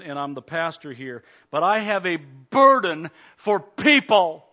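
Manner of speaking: loud, driving preacher's voice that rises on key words; full-force preaching. Acted. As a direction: hammer the warning home to the congregation.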